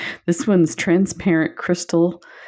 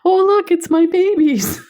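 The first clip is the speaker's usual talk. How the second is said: high, loud sing-song call; 4 dB louder than usual. Retold or imitated. Cycling past, calling to the neighbour's dogs, high and loud. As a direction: yell it out gleefully to the dogs. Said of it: "I'll yell".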